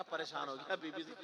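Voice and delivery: ordinary talk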